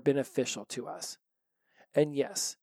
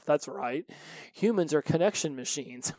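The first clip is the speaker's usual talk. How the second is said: ordinary delivery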